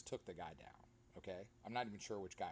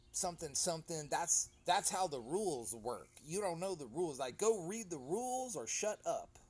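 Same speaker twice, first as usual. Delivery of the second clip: snarky referee; 5 semitones higher, 4 dB louder than usual. Retold or imitated. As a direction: sneer it like a smug ref. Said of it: "the referee gets all snarky with him".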